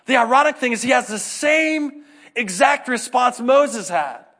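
ordinary talk

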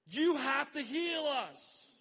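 loud, high, drawn-out demand; acted heckling shout. Preacher voices blind men heckling Jesus for healing, loud heckling. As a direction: heckle from the roadside, slow and insistent. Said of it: "they're almost heckling Jesus".

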